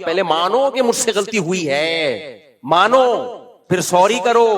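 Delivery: lightly voiced scolding wife; demanding wife. (بیوی کی نقل، ضدی لہجے میں)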